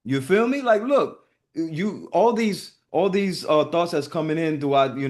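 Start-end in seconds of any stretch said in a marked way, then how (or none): none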